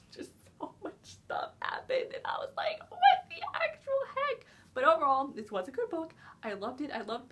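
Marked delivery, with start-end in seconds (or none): 0.1-2.8 breathy whispered overwhelm. Real, not acted. Reviewer overwhelmed recalling the plot, whispering. 2.9-4.5 much higher, squealing half-laugh half-cry; laugh-crying disbelief. Real, not acted. Reviewer reliving the shocking ending, laugh-crying squeal. Overwhelmed by the plot.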